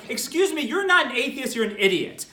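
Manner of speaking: ordinary delivery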